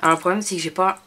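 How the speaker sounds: ordinary talk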